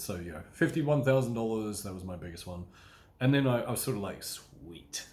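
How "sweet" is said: cool, hushed, unvoiced "Sweet" like a suave spy; a hushed spy's satisfied whisper; James Bond. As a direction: breathe it out like a smug secret agent. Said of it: "James Bond".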